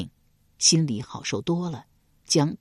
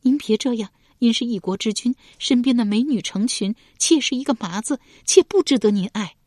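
put-on higher young woman's voice, pleading; 少女挣扎着哀求; struggling young woman; 7 semitones higher than usual. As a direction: push him away while begging. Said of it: "燕儿一边挣扎一边说道".

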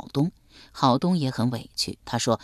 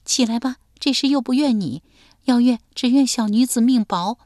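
talking through tears; 8 semitones higher, 4 dB louder than usual. Acted. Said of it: "边哭边说".

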